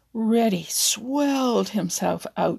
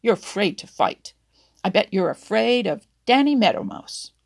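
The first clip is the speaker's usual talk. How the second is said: louder mocking dare; taunting; 8 dB louder than usual. A narrator's reading. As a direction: jeer at him to pick a fight. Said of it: "taunted Reddy".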